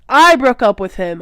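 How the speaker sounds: loud high shout; shouted back. A narrator's reading. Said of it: "I shouted back".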